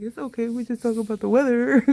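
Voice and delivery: high, loud, put-on agreeableness; mock small talk. Settling for weather talk with uninterested people, mock cheerful small talk.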